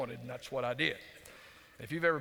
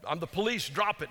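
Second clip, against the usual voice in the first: raised, higher pretend police command; mock holler; 4 semitones higher, 5 dB louder than usual. Heckling Cowboys receivers as pretend police, in a mock holler. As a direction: holler it like a joke police order.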